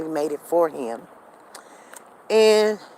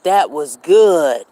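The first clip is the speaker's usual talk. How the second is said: imitating husband; 3 semitones lower, 6 dB louder, much slower than usual. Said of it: "he was like".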